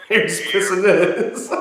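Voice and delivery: words slowed and broken up by laughter; slow, laughing retelling of grandpa's crude joke; laughing through it. Retold or imitated. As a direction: giggle your way through the line.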